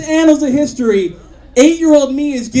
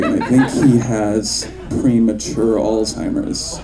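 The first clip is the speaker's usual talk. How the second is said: lower, hushed fake-worried voice; mock concern; 13 semitones lower, 3 dB quieter than usual. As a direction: pretend to be gravely concerned for the kid. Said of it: "and I'm like".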